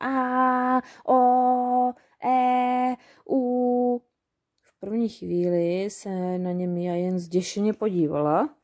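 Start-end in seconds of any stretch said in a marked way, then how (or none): 0.0-4.0 loud sung-out vowel yells; goblins shouting. A narrator's reading. Goblins start yelling vowels through the forest, loudly. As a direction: bellow each vowel. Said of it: "pustili do křiku".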